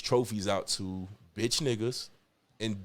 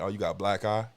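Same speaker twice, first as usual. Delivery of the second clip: ordinary delivery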